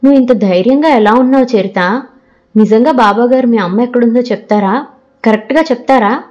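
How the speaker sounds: ordinary delivery, nothing marked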